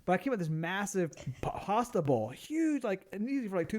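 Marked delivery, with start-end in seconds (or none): none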